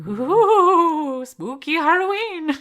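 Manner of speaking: high, loud hammed-up ghost voice; spooky voice. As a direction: wail it like a Halloween ghost. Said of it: "That's the best spooky voice I could do".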